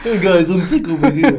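put-on light Liverpool accent, laughing. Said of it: "Suspiciously like [name]".

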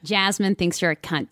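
American Jasmine voice; as Jasmine, American accent. Shown as a demonstration. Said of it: "and then as Jasmine".

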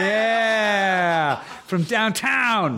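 announcer-style victory call; excited shout. Real, not acted. Celebrating a found fact.

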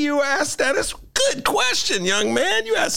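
high, hammed-up lecturing tone; mock pompous high voice. Host to young man, a hammed-up pompous high voice. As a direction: play a pompous old sage talking down.

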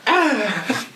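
a short, high, hammed-up grunt of effort; a lazy fighter's mock groan; a hammed-up grunt from a lazy fight scene. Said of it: "and he's just like".

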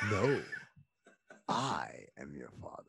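very low, hushed villain reveal; villain's movie line. Retold or imitated. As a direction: sink to a deep whisper, pausing after No. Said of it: "He says".

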